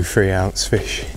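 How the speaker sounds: ordinary talk, nothing marked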